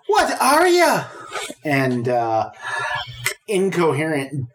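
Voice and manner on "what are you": put-on Irish accent retry. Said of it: "instead of Irish".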